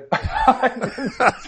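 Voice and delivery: cracks up laughing; burst of laughter. Real, not acted. Laughing at his own story.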